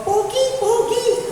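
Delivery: high squawky parrot call. Retold or imitated. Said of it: "sasabihin ng loro". (boses ng loro)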